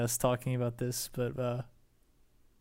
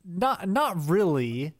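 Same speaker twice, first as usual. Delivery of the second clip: condescending adult; 5 semitones higher, 3 dB louder, much slower than usual. Retold or imitated. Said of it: "the guy asking the question was like".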